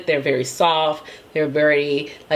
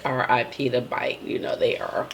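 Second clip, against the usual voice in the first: whisper; 6 semitones lower, 7 dB quieter than usual. Real, not acted. Mourning a defunct brand.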